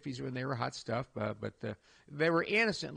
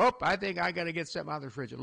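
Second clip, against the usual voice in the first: quick fake excuse; much faster than usual. Pretend excuse to check fridge.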